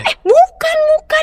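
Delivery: put-on high, quick girl's denial; put-on flustered young woman; startled girl denying. Girl denies rumor in toilet.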